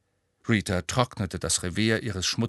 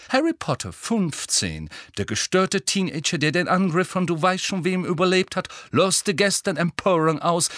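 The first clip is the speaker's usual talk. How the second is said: high, swelling tabloid proclamation; gleeful reporter dictating her scoop, pitched up; sensational headline; 8 semitones higher, 4 dB louder than usual. A narrator's reading. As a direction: proclaim each juicy phrase excitedly. Reporter composing a sensational article.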